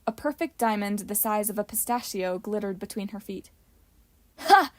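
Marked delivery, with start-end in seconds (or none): 4.4-4.7 loud, high triumphant bark; barked laugh. A narrator's reading. Rufus spotting the diamond at her feet, loud bark. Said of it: "Rufus barked when he saw".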